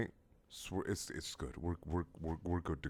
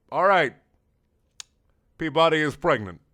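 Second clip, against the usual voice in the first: ordinary delivery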